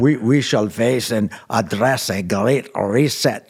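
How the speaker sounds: put-on slow heavy German accent, villain-like; thick German accent again. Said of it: "you got the voice down".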